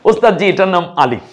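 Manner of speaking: ওস্তাদ জি called out loud and high; loud, high demo answer for the class. Shown as a demonstration. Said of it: "আমরা একটু লিখে দেখাই". (জোরে উঁচু গলায় উত্তর)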